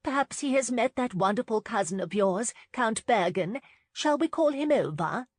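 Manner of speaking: high bright eager chatter; chirpy. A narrator's reading. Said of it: "her hostess chirped".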